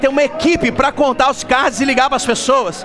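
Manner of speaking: high, loud fired-up exhortation; rally the crowd at full force; fast, loud, high revival preaching. Acted.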